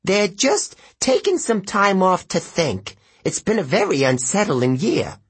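hide the panic badly; panicky satyr. A narrator's reading. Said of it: "the panic in his voice".

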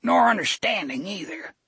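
put-on thick, slurred, high old drunk; drunk old man. A narrator's reading. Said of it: "the old bastard said thickly".

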